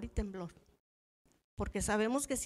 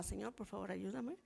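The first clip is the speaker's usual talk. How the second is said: soft pleading whisper to God; hushed prayer; 10 dB quieter than usual. Retold or imitated. Preacher praying under her breath mid-sermon, hushed. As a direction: breathe it as a silent plea.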